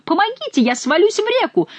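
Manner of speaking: high panicked cry; cry for help. A narrator's reading. Monk on the cliff path cries for help, panicked. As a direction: shriek for help in fright. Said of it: "кричал монах".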